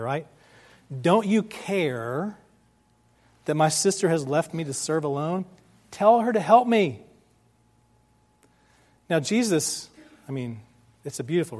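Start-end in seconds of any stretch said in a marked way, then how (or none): 1.0-7.0 passive-aggressive. Acted. Upset Martha demanding help from Jesus, passive-aggressive. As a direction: complain pointedly, then push the demand hard. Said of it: "a little bit of passive aggression".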